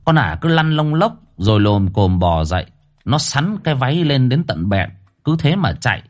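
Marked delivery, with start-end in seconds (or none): none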